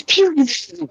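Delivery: high-pitched "pew, pew, pew"; quick run of high sound-effect pews; imitated springing noise. Describing a boxer jumping around the ring, imitated springing noises.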